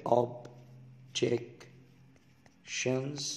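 "Objections" with the first o opened wide like ah. Shown as a demonstration. Teacher stretching "Objections" to show students the open o. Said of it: "بيفتحوا الأو شوية".

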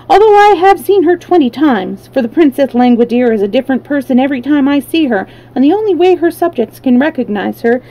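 high, lively character voice; talkative wheeler. Acted.

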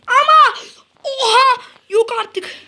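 high shocked wail; shocked cry. Real, not acted. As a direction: cry out in disbelief. Opponent scores, shock.

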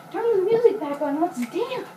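high, loud party call; excited shout. Real, not acted. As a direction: call out to dance. Calling for music.

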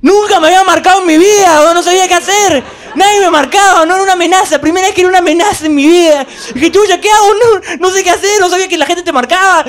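put-on boy suddenly marked, frantic and laughing; panicked. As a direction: panic about being marked. Panicked high voice while marked.

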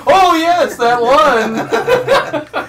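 loud, high, laughing put-on agreement; mocking fake recognition. Teasing friends over a name that sounds made up, loud mock recognition. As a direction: blurt it high, then drag out the last words.

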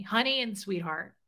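usual speaking voice

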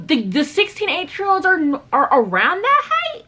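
disbelief. Real, not acted. Disbelief at members' height.

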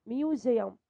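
ميوزيوم with the s said as z, myu-zee-um; model pronunciation. Shown as a demonstration. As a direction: make the s a clear z between the vowels. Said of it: "عشان بين حرفين متحركين". (نطق ميوزيوم بحرف زد)

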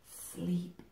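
soft, fading sleep; hushed lull. Acted. Mother Hen hushing her chicks to sleep, fading soft. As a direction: lull them off, almost silent.

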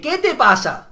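loud, confrontational snap; angry challenge. Retold or imitated. Student turns on the bully, loud and angry. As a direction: square up to him.

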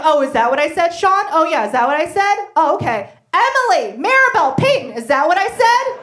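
high, loud mock-innocent guy; mocking a confused man. Comic mimicking a boyfriend caught out. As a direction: play dumb, loud and high, repeating the question. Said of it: "You're like".

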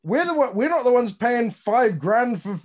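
high, loud, worked-up rant; a Brit fired up about paying for braces, high and loud; indignant rant. Real, not acted. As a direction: let the outrage run high and loud.

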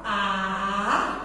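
"A" held long and low; sounding out. Shown as a demonstration. Teacher sounding out while she writes, drawn out. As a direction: stretch out "A" as if writing it. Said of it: "a tia [name] vai escrever".

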